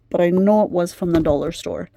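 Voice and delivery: normal talking voice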